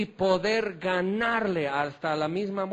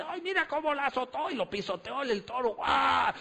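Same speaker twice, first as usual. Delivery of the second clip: quick, higher, hushed imitation of a thrilled rodeo fan; mock thrilled onlooker; 5 semitones higher than usual. Preacher mimics rodeo fans, mock thrilled and hushed. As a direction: gasp along like a fan watching the bull. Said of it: "y dicen".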